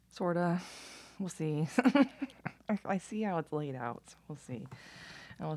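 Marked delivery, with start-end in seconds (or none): 1.7-2.6 short real laugh. Real, not acted. Laughing at own remark.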